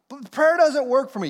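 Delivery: high, loud whining protest; whiny complaint. Acted. Churchgoer whining to the pastor that prayer fails. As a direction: complain like a stubborn kid.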